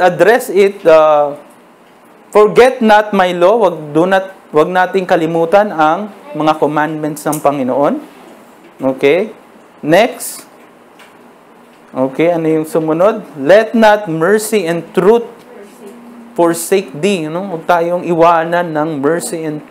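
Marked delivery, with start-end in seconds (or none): 2.3-3.7 scripture quote. Acted. Quotes the commandment. 13.4-17.2 scripture reading. Acted. Reads the verse.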